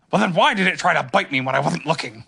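put-on animal voice; Tigger. A narrator's reading. Tigger warns Pooh about the table, character voice. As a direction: interrupt as an odd, eager creature. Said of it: "said Tigger".

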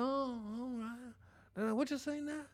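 a hint of a mild, hard-of-hearing elder; imitating grandparent. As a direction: grandparent asking a grandkid to repeat. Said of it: "grandpa and grandpa. They be like".

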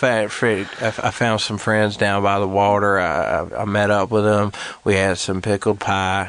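low-pitched Southern storyteller; low, put-on Southern voice; Tennessee Southern. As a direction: tell it in a deep Tennessee accent. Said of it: "Tennessee Southern".

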